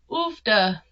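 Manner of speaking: mock Minnesotan exclamation; playful Minnesota accent. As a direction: toss it out in fake Minnesotan. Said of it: "do a Minnesota accent".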